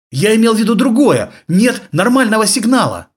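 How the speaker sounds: high, loud frantic pleading. Acted. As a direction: blurt it out in a panic. Frightened soldier explains to boss.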